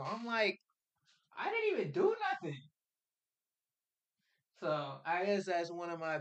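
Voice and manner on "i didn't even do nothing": put-on indignant kid. Kid protesting to principal.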